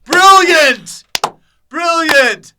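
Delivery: very loud, high shout; hammed-up high yell; mock exclamation. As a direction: cry it out like an overexcited pitchman.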